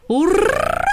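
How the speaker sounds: high joyful cheer; joyful howl. A narrator's reading. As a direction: cheer it out like happy greyhounds. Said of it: "радостно взвыли борзые". (радостный возглас)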